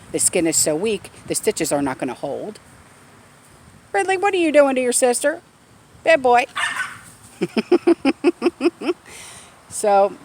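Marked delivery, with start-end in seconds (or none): 3.9-6.5 high, loud, fast pet scolding; scolding the puppy. Real, not acted. Dog owner scolding her puppy for bothering his sister, playful scold. 7.4-9.3 high bright laugh. Real, not acted. Owner laughing after scolding her puppy, high laugh.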